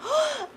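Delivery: sharp high gasp of delight; excited gasp. Real, not acted. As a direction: gasp with happy surprise. Sees viewer Arena join.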